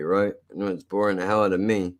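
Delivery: normal talking voice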